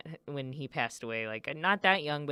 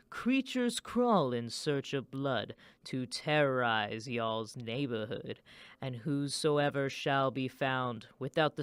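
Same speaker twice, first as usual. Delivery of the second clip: slow, low, spooky recitation of the [name] rap; slow, low, ominous Vincent Price-style reading; Vincent Price impression; 3 semitones lower, slower than usual. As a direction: intone it like a horror-film narrator, drawn out. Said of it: "here's my attempt".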